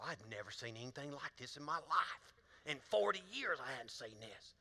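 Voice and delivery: low, soft disbelief; hushed astonished mechanic. Retold or imitated. Mechanic telling the preacher about the engine, low and hushed. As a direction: sound amazed and grim.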